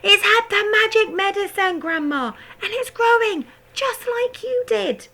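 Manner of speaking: put-on high, loud boy's voice; excited shout. A narrator's reading. George shouting excitedly to Grandma. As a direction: shout it while hopping about.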